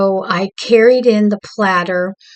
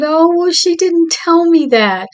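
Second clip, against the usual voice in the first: much higher, mock-upset voice; lightly acted tearful excuse, pitched way up; retold tearful protest; 9 semitones higher than usual. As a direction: protest in a high voice, imitating hurt. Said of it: "here I am still crying".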